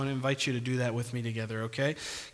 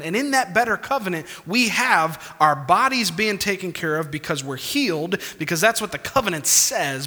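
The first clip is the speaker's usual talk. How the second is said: louder, higher impassioned preaching; 5 semitones higher, 5 dB louder than usual. Acted. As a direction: press the point home to the congregation. Preaching with rising force.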